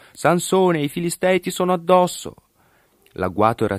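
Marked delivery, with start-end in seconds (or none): 0.1-2.3 high, raised warning call; grido d'allarme. A narrator's reading. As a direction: call out high to alert him. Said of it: "lo legò e gli gridò". (grido acuto, voce alta)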